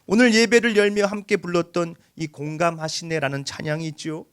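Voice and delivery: ordinary talk, nothing marked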